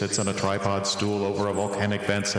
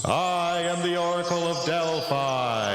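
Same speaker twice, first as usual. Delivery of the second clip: slow, high booming proclamation; grand oracle; 9 semitones higher than usual. Acted. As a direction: announce yourself like an ancient oracle.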